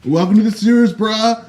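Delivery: high, loud dude-bro voice; brash frat guy greeting, cranked up high; Jared frat bro. Pretend. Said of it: "He sounds like a frat bro".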